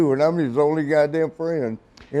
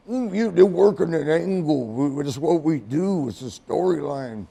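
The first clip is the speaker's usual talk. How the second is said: stretched-out wrestler impression; Randy Savage; 3 semitones higher than usual. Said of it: "Randy's coming in".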